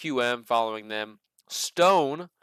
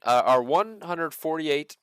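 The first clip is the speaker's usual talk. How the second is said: ordinary talk, nothing marked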